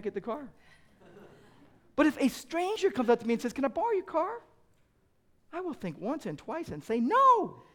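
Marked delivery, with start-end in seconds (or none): 3.5-4.4 high, light voice of a stranger asking; a stranger pitched up high. Acted. 7.0-7.6 high, louder flat-out no; a sharp high no; firm refusal. Acted. Father refusing a stranger.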